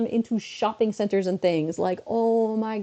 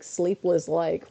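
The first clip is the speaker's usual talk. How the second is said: ordinary delivery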